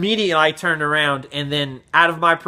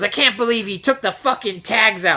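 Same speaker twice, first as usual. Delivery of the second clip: higher-pitched mock angry customer; retold pissed-off; 6 semitones higher than usual. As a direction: imitate an irate repeated accusation. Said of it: "He was pissed".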